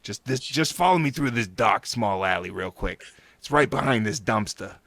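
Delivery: put-on sly New York hustler accent; lightly voiced New York con man accent; shady New Yorker. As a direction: lure a tourist into an alley in a New York accent. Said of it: "You're crazy good at that".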